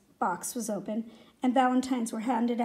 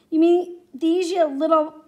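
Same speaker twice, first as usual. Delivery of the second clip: loud, higher taunting whine; bully's raised, sneering taunt; mocking, whiny; 4 semitones higher, 7 dB louder than usual. A narrator's reading. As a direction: jeer at him in a whiny voice. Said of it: "Billy said in a mocking, whiny voice".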